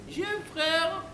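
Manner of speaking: put-on high, sing-song girlish falsetto; ditzy bimbo. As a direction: answer in a silly high bimbo voice. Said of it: "elle me fait". (voix aiguë de bimbo)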